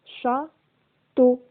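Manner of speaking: "Château" split as châ, pause, teau; syllable by syllable. Shown as a demonstration. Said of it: "dans la deuxième syllabe". (« Château » en deux syllabes bien séparées)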